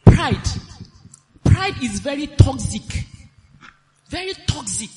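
loud preaching, hammering the word pride; preaching at full force. Acted. Wife warns the congregation about pride, loud preaching. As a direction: drive the warning home to the hall.

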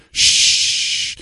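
drawn-out serpent hiss; the preacher imitates a serpent hissing; snake hiss. Shown as a demonstration. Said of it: "to simulate the sound of a snake".

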